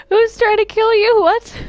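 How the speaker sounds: high, loud startled question; alarmed disbelief. Acted. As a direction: blurt it out in shock. Startled by claim someone is killing her.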